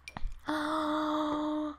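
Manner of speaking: long soft gasp of awe. Real, not acted. Fan seeing new items, long soft gasp. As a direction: draw in a long breath.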